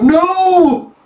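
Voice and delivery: high, loud anguished wail; full-voiced "no" cried at the top of the range; dramatic scream. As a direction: scream it out in melodramatic horror. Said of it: "he screams like".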